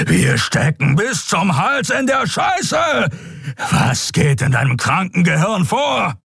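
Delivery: high-pitched enraged shouting; raging tirade at full pitch; furious roaring. A narrator's reading. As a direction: roar it in their face. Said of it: "Kerus Brüllen brachte sie zum Schweigen".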